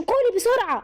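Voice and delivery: very high, insistent order; heated, high-pitched command; urgent demand. A narrator's reading. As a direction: demand it impatiently, pitch jumping up. Orders sister to confess quickly.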